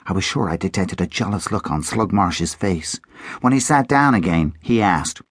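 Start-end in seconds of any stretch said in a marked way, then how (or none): none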